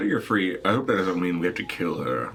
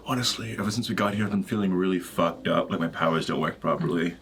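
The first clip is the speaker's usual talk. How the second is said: ordinary delivery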